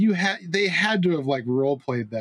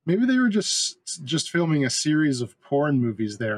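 ordinary talk

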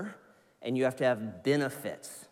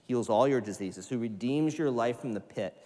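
ordinary delivery

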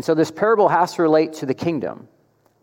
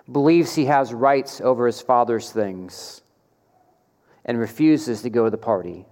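ordinary delivery

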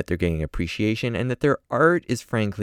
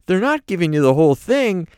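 [name] impression; 13 semitones higher, 7 dB louder than usual. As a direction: demand your lawyer as Paul. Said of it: "He's like".

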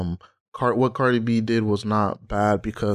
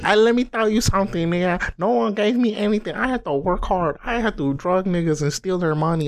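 much higher, mock-proud bragging voice; 9 semitones higher than usual. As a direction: ham up her boast about hard work. Mocks [name]'s bragging.

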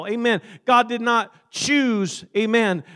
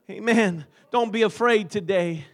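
ordinary delivery

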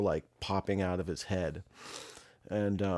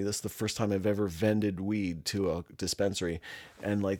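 usual speaking voice

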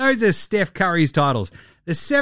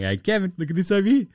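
higher, taunting voice of the basketball gods dangling a title; sing-song divine tease, pitched up; teasing basketball gods; 4 semitones higher, faster than usual. Retold or imitated. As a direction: lure him in like a cruel trickster god. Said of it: "it's just the basketball gods going".

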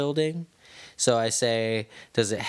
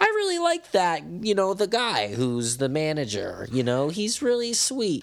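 mom voice; 9 semitones higher than usual. Acted. Imitating mom praising the manager as really sweet, high mom voice. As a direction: put on a high, approving mom voice. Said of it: "that's my mom voice right there".

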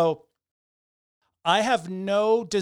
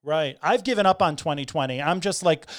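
ordinary talk, nothing marked

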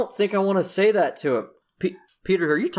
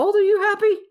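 character voice; 3 dB louder, faster than usual. Acted. GM voices a character, high pitch.

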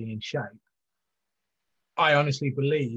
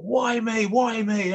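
high, despairing "why me"; anguished cry; 9 semitones higher, much slower than usual. Retold or imitated. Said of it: "sort of saying".

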